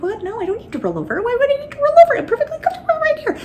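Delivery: high, fast, laughing indignation; laughing protest. Retold or imitated. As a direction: giggle through the mock protest.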